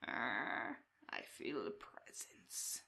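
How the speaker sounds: low, slow, breathy rasp of a bird-headed prisoner; slow raspy creature. Acted. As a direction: creep it out slowly like a chained crow man. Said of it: "speaks with a slow, raspy cadence".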